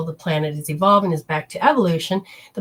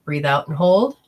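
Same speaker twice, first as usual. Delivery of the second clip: ordinary delivery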